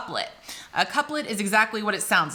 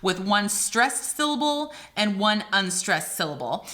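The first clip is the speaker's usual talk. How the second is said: normal talking voice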